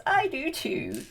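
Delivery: high sweet fairy. Retold or imitated. Flora agrees, high. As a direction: chirp it like a godmother. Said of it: "she says".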